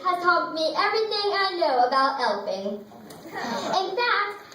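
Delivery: ordinary talk, nothing marked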